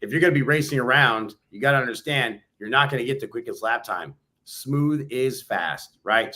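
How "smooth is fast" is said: said very slowly, word by word; slow emphasis. Shown as a demonstration. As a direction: lay out smooth is fast slowly. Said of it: "Slow down to speed up".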